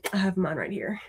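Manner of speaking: unvoiced little aside; hushed show-and-tell whisper. Real, not acted. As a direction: lean in and whisper it. Aside while showing her book.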